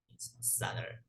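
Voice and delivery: soft whisper of the meaning; whispered repeat. Shown as a demonstration. Teacher whispers the meaning again to the class. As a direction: whisper it under your breath. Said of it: "teacher repeats the word meaning".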